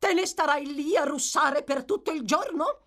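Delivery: scolding wife. A narrator's reading. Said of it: "domandò la signora Bumble".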